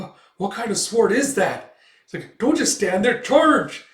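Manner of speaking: alarmed order. Acted. Commander rallying soldiers against Guts, rising yell. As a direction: shout the troops forward. Soldiers see the huge sword.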